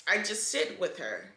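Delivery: holding back tears, voice sinking toward the end; fighting tears while she speaks; getting emotional. Real, not acted. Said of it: "oh, I'm getting emotional".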